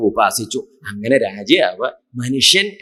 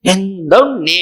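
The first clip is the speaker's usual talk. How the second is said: higher, louder wail of protest; hurt father crying out in disbelief; plaintive, drawn-out appeal; acted distress; 5 semitones higher, 6 dB louder, much slower than usual. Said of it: "രാജാവ് സങ്കടം പറഞ്ഞു".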